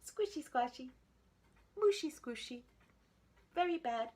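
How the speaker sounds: high, squealy piglet voice, defiant; piggy voice. A narrator's reading. The piggy answering her family, high piggy voice. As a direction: sing it out like a stubborn little pig. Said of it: "Said the piggy".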